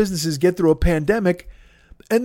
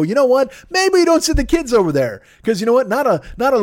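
mock dumb parent; 6 semitones higher than usual. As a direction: voice jumps high, a dopey realization. Mocking parents realizing too late.

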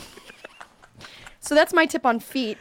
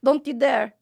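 Filipino accent with an Indian twang; 2 semitones higher than usual. Shown as a demonstration. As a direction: scold with a Filipino-Indian lilt. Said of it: "I just did it".

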